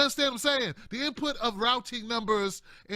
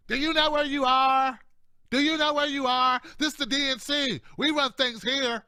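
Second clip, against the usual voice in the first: loud, higher, menacing gatekeeper voice; hostile party boss; 4 semitones higher, 4 dB louder than usual. Acted. DNC insiders telling an outsider she doesn't belong, menacing. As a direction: bark it like a bouncer guarding the door.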